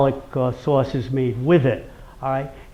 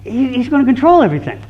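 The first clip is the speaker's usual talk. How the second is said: upset daughter; 10 semitones higher, 5 dB louder, faster than usual. Retold or imitated. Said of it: "She goes".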